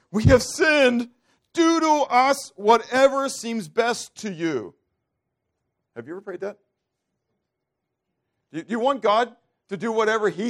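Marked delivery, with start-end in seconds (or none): 0.1-4.7 much higher, louder, slow preached cry; the children of Israel crying to Yahweh, loud and slow; impassioned cry. Acted. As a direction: cry it out to heaven, drawn out. Israel's cry read aloud.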